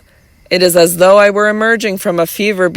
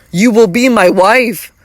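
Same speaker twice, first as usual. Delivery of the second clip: overjoyed exclaiming; 3 semitones higher than usual. A narrator's reading. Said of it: "I could not help exclaiming, overjoyed".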